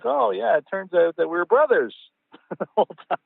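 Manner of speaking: high, hammed-up excited realization; mock amazed discovery. Dad and passenger find they are related, mock excitement. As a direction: imitate someone delighted by a surprise connection. Exaggerated retold discovery of kinship.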